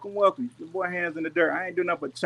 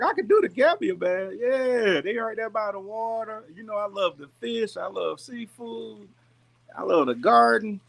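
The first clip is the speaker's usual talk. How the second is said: high, eager inner voice; daydreaming out loud; 8 semitones higher than usual. Retold or imitated. Recalling his thoughts about life in the Gambia, high and eager. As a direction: sell yourself on the dream of moving there. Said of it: "thinking to myself".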